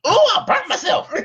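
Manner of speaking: high-pitched mimicked surprise at a burn; mock pained yelp. Mimics someone burnt after warning.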